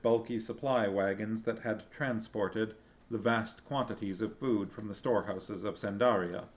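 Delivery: usual speaking voice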